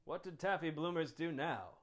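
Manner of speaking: normal talking voice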